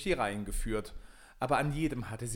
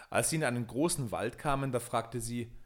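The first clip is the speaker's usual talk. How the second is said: ordinary delivery, nothing marked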